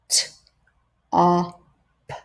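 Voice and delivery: "T-, ah, p" as separate sounds; segmented sounds. Shown as a demonstration. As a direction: sound out "T-, ah, p" one by one. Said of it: "breaking words apart into their sounds".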